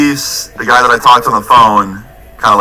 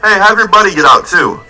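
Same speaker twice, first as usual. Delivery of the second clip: higher, a calling-out cop shout; mock cop yell; 8 semitones higher than usual. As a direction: call across the driveway like a cop. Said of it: "I hear one of the cops yell".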